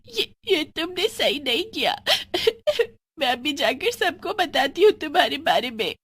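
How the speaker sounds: high, trembling threat; scared but defiant; frightened girl. Acted. Friend threatening to tell everyone, high frightened voice.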